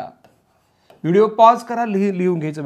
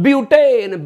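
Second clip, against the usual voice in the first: Butane loud and high, falling at the end; punchy classroom call; shouted drill; 5 semitones higher, 7 dB louder, slower than usual. Shown as a demonstration. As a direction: belt out butane to lead a chant. Chants alkane against alkyl name.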